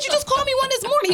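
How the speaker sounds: high, rapid comeback to her son; indignant retort, high and fast. Retold or imitated. Mother retorting to her son who called her a liar, high and fast. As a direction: fire it back fast and high.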